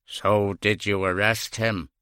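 low, slow dim-witted voice; dull man voice. Acted. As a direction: plod through it like a dull man. Dull DS Quinn asks.

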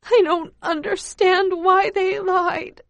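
put-on high, slow trembling child's voice; child's quivering, tearful question. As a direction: let the voice quiver with confusion. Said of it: "My voice quivers as I say it".

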